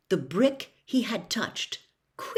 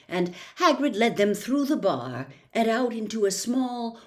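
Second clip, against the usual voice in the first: plain reading voice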